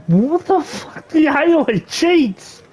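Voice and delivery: high, slow, drawn-out whine of defeat; near-tears whine. Real, not acted. Gamer losing a card match to the AI, near-tears whine. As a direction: whine like you're about to cry. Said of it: "I'm on the verge of crying".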